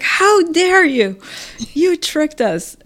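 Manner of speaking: high, drawn-out mock outrage; retold indignant yell. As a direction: imitate an outraged scolding, stretching each word. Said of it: "then she yelled at both of us".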